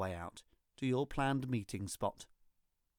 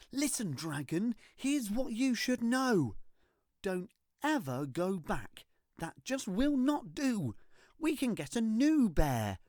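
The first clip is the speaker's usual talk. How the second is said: put-on scolding child; 9 semitones higher, 3 dB louder than usual. A narrator's reading. Child scolds dragon firmly.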